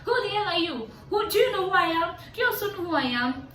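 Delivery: yelling who the hell are you at the guy; mock retold yell. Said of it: "I was like".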